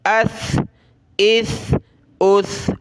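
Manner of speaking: careful ath, ith, uth for each اس; no whistle. Shown as a demonstration. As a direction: lisp the end of اس without hissing. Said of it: "ان میں سیٹی کی آواز نہیں آنی چاہیے".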